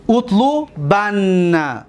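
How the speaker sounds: اطلبن drawn out slowly, utlubanna with doubled n; slow Arabic demo. Shown as a demonstration. Teacher drawing out an Arabic verb for students, slow and deliberate. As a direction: spell out اطلبن syllable by syllable, doubled نّ at the end. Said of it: "ببینید".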